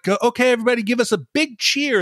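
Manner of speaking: imitating a cheerleader. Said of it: "he's like".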